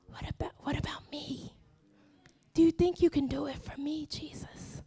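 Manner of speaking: small, whispered appeal; timid pleading under the breath; whispered plea. Acted. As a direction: drop to a hushed, hesitant whisper. Acted timid plea after loud list.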